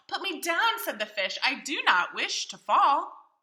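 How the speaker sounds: fearful fish. Acted. Fish panics being lifted.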